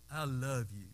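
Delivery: gentle motherly I love you; tender I love you. Retold or imitated. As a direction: say it like a mother to her child.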